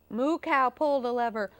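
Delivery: ordinary delivery, nothing marked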